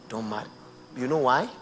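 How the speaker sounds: ordinary talk, nothing marked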